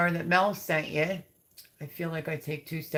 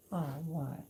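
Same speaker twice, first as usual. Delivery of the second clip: ordinary talk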